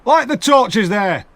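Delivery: slow, high, loud command; shouted order. Acted. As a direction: bark it to the soldiers. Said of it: "he shouted to the soldiers".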